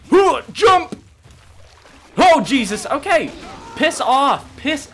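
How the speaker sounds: loud, high startled yelps; panicked shout. Real, not acted. Player chased by a pissed dinosaur, panicked shouting. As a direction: shout frantically while fleeing an attacker.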